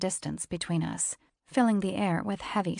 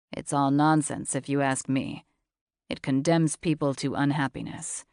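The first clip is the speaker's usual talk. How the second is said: lower, husky-free steady man's voice; low male voice; 4 semitones lower, 4 dB louder than usual. A narrator's reading. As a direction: voice him low and cool.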